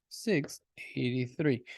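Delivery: a slow whispered correction; whispered retake. Shown as a demonstration. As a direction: whisper "Six eighty-three" slowly. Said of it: "that six was trash".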